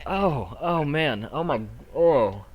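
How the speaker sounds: high, loud, overwhelmed "oh man"; flustered relief. Acted. Teen host learns the horse was a prank, loudly flustered. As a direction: exclaim loudly as the shock sinks in.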